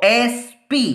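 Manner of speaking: SP slowly, letter by letter; slow teacher's demo of the letters in SP; sounded out. Shown as a demonstration. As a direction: stretch S, then P in SP. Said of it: "first letter का sound आ रहा है".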